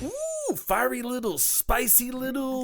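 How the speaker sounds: high, delighted cooing; excited cooing. Acted. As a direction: coo over the little creature. Admiring the Pokemon.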